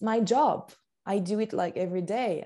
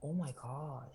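breathed out low under the breath; daunted whisper; 5 semitones lower, 12 dB quieter than usual. Real, not acted. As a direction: whisper it, overwhelmed by the question. Daunted by a big question.

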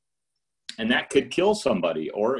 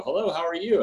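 awkward phone hello; 8 semitones higher than usual. Retold or imitated. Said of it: "the calls are really awkward because you go".

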